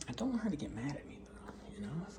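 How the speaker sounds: hushed, fast whisper. Real, not acted. A husband whispering about his wife, hushed. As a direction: whisper it so nobody hears.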